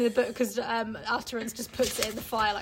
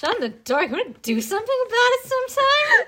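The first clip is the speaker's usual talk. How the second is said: much higher and loud, squaring up; hammed-up aggressive challenge; mock confrontation; 13 semitones higher, 9 dB louder than usual. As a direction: challenge them like a playground bully.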